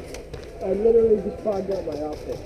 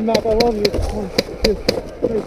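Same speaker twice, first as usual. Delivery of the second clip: normal talking voice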